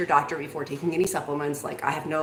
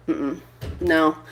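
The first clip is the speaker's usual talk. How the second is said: ordinary talk, nothing marked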